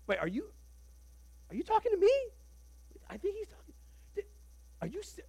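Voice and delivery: high, breaking disbelief; a shocked squeak of recognition; startled. Acted. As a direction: suddenly realize he means you. Priests realize Jesus means them.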